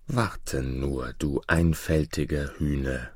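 low, soft aside; thinking to himself. A narrator's reading. Boy secretly plots against the giant, low soft aside. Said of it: "dachte er".

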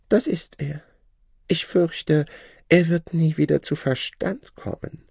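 high, lordly and condescending; haughty shadow. Acted. The Shadow lording it over the learned man, haughty high voice. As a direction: talk down to your old master.

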